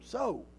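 so drawn out and lifted high; stress on so. Shown as a demonstration. Preacher stressing "so" to his church, lifted high. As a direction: lean on the word so, high and soft. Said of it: "I always like to emphasize the word".

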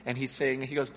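ordinary delivery

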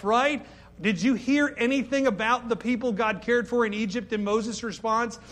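usual speaking voice

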